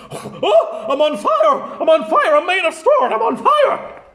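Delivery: high, loud panicky yelps; panicked scarecrow. Acted. The Scarecrow on fire, crying out in high panic. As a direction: cry out like you are burning.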